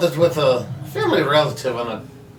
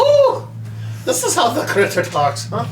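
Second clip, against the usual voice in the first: mock Scottish; 9 semitones higher than usual. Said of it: "He's got a slight Scottish accent".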